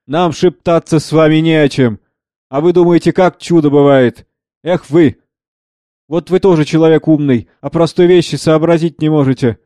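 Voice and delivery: outspoken acted rebuff; loud. Kondraty refuses to whisper with Savva, loudly scornful. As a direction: refuse to whisper, speak out openly. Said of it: "громко".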